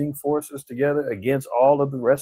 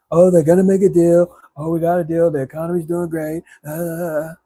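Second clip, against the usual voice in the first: mock smooth-talking Trump; 6 dB louder than usual. Mocking Trump smooth talk.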